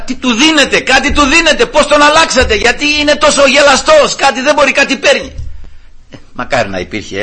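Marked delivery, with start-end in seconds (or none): 0.0-5.3 high loud amazed questioning; suspicious mother asking. Retold or imitated. Margarita puzzled at her cheerful son, loud and high. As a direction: demand what they give him. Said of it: "Και τελικά".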